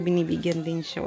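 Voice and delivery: ordinary talk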